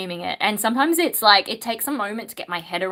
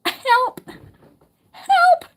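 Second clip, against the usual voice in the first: much higher, louder comic yelps; mock cry for help; 6 dB louder than usual. Reacting to the burpy screams, hammed-up cry for help. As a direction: squeal for help like it's a joke. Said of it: "it's just like".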